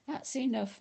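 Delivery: yelled. A narrator's reading. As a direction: call out to stop them. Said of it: "Mister Pendanski yelled".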